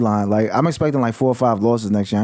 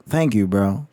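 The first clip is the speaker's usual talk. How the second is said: ordinary talk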